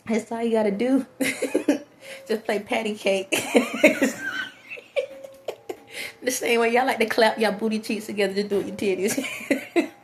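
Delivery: real laughter between the phrases; laughing at self. Real, not acted. Speaker joking about patty cake, laughing at self. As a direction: crack up at your own joke while talking. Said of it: "I'll laugh at myself".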